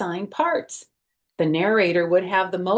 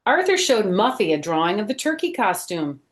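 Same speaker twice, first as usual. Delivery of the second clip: usual speaking voice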